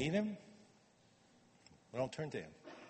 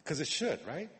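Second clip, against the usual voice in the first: normal talking voice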